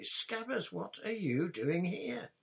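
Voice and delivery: high, puzzled exclamation; surprised. Acted. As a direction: ask it in disbelief. Ron puzzled at his rat.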